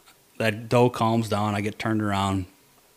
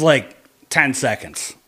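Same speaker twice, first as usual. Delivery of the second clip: ordinary delivery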